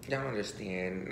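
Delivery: normal talking voice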